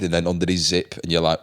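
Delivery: normal talking voice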